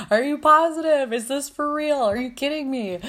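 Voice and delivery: retold disbelief. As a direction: keep asking as if it can't be true. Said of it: "I couldn't believe it".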